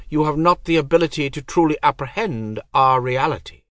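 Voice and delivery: high, cold divine voice; goddess voice. Acted. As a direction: speak as an aloof goddess.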